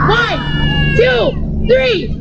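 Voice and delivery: high, shouted count to three; loud countdown. Real, not acted. Teacher shouting a countdown so the kids roar together. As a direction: call out the count to cue the roar. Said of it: "nice and loud".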